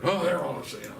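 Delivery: mimicking couple. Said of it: "couple's quoted reply".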